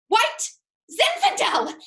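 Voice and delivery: high, loud outraged echo; appalled disbelief. Acted. Server aghast at table eleven's wine order, appalled. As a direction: repeat it in scandalised disbelief.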